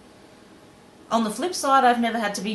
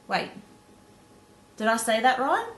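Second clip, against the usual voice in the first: normal talking voice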